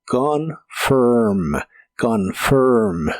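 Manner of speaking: slow teacher's model of "confirm"; tongue pulled back. Shown as a demonstration. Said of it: "con tu lengua retraída hacia atrás".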